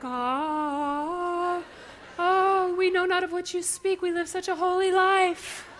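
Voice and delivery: lightly voiced prim saint, laughing; mock holy voice. Listeners pretending innocence, in a lightly put-on pious voice. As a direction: play the smug churchgoer.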